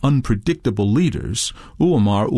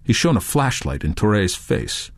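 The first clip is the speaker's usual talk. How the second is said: plain reading voice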